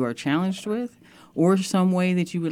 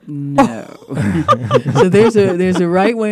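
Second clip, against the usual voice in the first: laughing; 5 semitones higher, 10 dB louder than usual. Real, not acted. Guest laughs at host's joke.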